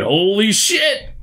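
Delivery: high, drawn-out cry of amazement; amazed exclamation. Real, not acted. Host reacting to the latest episode, amazed. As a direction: stretch it out high in awe.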